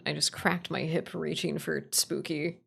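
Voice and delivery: normal talking voice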